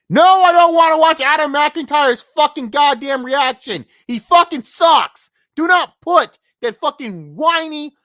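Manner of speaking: real angry outburst, slowing down; furious shout. Real, not acted. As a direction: yell it loud and high, spitting contempt. Angry outburst at Adam McIntyre.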